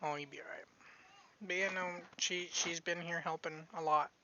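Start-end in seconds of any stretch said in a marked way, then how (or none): none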